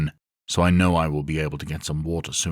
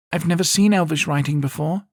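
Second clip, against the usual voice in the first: put-on high, louder girl's voice, curious; young girl's voice; 12 semitones higher, 5 dB louder than usual. A narrator's reading. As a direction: peek in eagerly as Isara. Says Isara.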